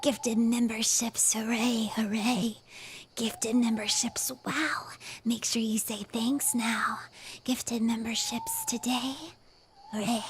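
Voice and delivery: put-on slow eerie whisper of a spooky child; creepy boy voice. Streamer thanking chat for gifted memberships in a creepy boy whisper. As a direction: whisper it like an unsettling little boy. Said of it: "in the creepy boy voice".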